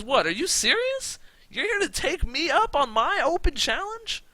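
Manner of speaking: high, loud incredulous scoff; mock disbelief. Champion scoffing at the challenger, high loud disbelief. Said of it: "And he goes".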